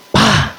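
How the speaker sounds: short, sharp vocal smack; sudden burst mimicking a slap; imitated slap. Father slaps his son to change the channel, imitated smack. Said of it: "slap me on the head".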